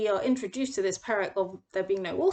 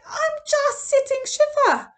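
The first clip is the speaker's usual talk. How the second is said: much higher, louder and slow, an outraged outburst; exasperated Moshe; 12 semitones higher, 6 dB louder, slower than usual. Retold or imitated. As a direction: blow up at the crowd interrupting your mourning. Moshe angry at mourning interrupted.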